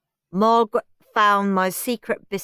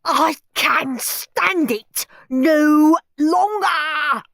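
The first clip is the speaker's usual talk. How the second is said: desperate outcry; 9 semitones higher, 7 dB louder, much slower than usual. Acted. Henry's desperate diary outburst.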